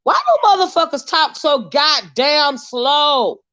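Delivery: exasperated slow mocking shout; mock slow talk. Shown as a demonstration. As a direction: stretch every word to mock them. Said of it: "I can't stand when motherfuckers talk slow".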